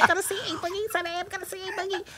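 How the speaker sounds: mother imitates daughter bragging to cousins; giddy child imitation. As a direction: squeal it like an excited kid.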